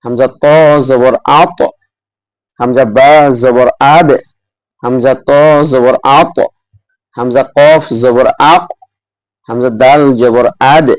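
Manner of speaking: each final letter in আত, আব, আক, আদ bounced with an echo; qalqalah drill. Shown as a demonstration. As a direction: recite আব and আক with a sharp echo. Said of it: "আবার উচ্চারণটা বুঝাই দিচ্ছি".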